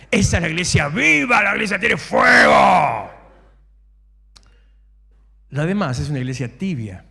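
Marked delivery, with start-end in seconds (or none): 0.1-3.1 loud, high preaching shout; fiery preaching. Real, not acted. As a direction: proclaim it with fire to the room. Preacher rousing the church. (prédica encendida y a gritos)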